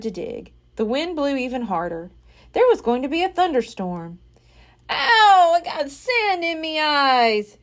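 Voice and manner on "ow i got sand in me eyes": high, slow whining pirate; Pinky whining about sand in his eyes; whined. A narrator's reading. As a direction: whine in pain. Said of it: "Pinky whined".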